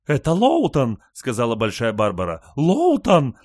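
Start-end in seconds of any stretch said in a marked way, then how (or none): 0.1-1.0 high, loud distraught cry; frantic insistence. A narrator's reading. Frantic mother insists it is him. 2.5-3.4 high, loud call of his name; desperate scream. A narrator's reading. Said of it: "кричала она".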